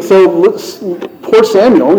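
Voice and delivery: normal talking voice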